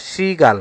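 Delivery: শৃগাল said as "sri-gal"; model pronunciation. Shown as a demonstration. Teacher answers the quiz on শৃগাল, modelling "sri-gal" carefully. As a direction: open শৃগাল on a clear "sri". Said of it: "শৃগাল শব্দের সঠিক উচ্চারণ রূপ কোনটি?".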